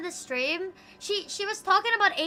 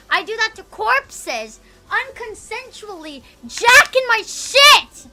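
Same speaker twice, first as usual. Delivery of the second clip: slow, rising to a high loud holler; slowed, hammed-up holler that climbs higher; building mock yell; 7 semitones higher, 11 dB louder, slower than usual. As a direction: ham it up louder and louder. Escalating shock joke.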